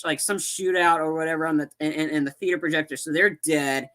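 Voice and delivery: usual speaking voice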